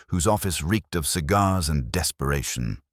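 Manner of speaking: plain reading voice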